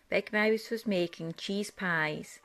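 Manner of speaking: plain reading voice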